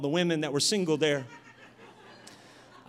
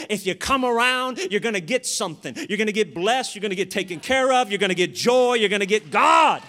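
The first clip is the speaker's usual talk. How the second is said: full-force preaching; 2 semitones higher, 7 dB louder than usual. Acted. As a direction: build louder to a shouted peak. Preacher builds to a peak.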